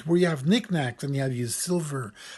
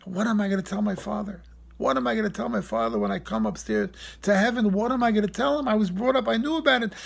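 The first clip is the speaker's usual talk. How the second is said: anguished self-questioning; 6 semitones higher, 3 dB louder than usual. Retold or imitated. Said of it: "I said to myself".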